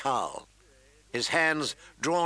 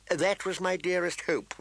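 high, sugary courtier voice; cloyingly courteous; cloying eunuch; 6 semitones higher than usual. A narrator's reading. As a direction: lift the pitch and lay on the sweetness. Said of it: "The eunuch's cloying tones".

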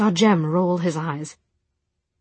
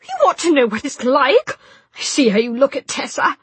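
high, loud jealous taunt; spiteful young woman; 8 semitones higher, 7 dB louder than usual. Acted. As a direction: lash out with spite. Jessamine lashing out.

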